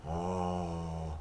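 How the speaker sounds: low, hushed, very soft close-up voice; playfully intimate murmur; intimate mic voice. Shown as a demonstration. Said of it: "get really intimate into the microphone".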